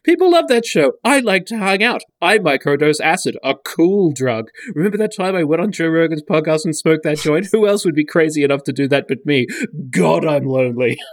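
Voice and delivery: high mock-desperate bragging, sinking into self-pity; needy put-on voice. Spoof billionaire boasting of being fun, hammed-up needy voice. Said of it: "it's voiced".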